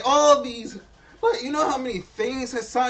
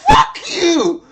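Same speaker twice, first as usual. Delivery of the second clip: loud, higher burst at the screen; angry shout; 6 semitones higher, 8 dB louder than usual. Real, not acted. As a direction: yell it at the screen. Streamer yells at the screen.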